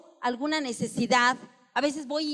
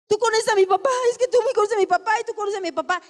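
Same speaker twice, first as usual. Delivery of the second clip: mock rambling plea, higher and rushed x1.7; rambling prayer; 5 semitones higher, 3 dB louder, much faster than usual. Preacher imitates someone praying about another's problem, hurried mock plea. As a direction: rattle off a muddled prayer about dad. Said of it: "ni siquiera es mío y me lo apropio".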